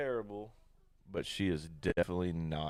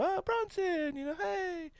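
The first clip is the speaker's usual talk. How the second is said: much higher, cheery imitation of the missus greeting on the phone; imitating his wife; slower than usual. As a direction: mimic the wife chirping hello into a video call. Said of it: "I hear her go".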